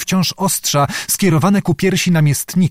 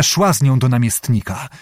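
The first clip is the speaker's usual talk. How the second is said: ordinary delivery, nothing marked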